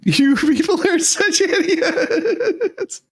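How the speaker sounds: high, slow, laughing out the insult; cackling at them in disbelief. Real, not acted. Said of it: "too busy laughing at the hair".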